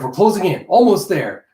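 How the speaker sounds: excited tracker calling out; eager call. Acted. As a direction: sing it out as you close in. Jeff tracking someone on radar.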